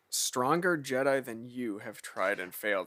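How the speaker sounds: low, slow, soft quoted sneer. Retold or imitated. Villain boasting of beating stronger Jedi, understated sneer. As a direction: deliver a measured, understated sneer. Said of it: "he says that with a sneer".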